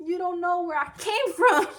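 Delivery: high, laughing through the words; laughing defiance. Retold or imitated. Patient laughing off the nurses, high and giggly. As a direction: laugh while you swear it off.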